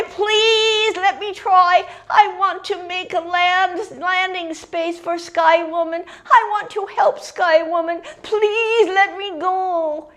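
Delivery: high, loud, eager little bird; pleading young grebe. A narrator's reading. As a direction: beg like a youngster desperate for a turn. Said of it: "The young grebe came forward and he said".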